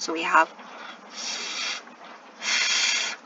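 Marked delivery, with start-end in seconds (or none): 1.1-3.2 "f" as one long unvoiced friction sound; phonics sound demo. Shown as a demonstration. Teacher showing that two Fs make one sound, phonics demo. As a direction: hold "f" as a lone hiss of breath. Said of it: "these two Fs will go together to make one sound".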